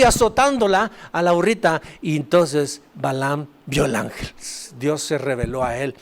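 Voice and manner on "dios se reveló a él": ordinary delivery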